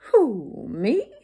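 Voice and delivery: loud, hooted who, rising me; storybook owl taken aback; surprised owl. A narrator's reading. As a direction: question it in disbelief. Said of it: "said the owl".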